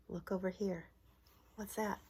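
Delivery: usual speaking voice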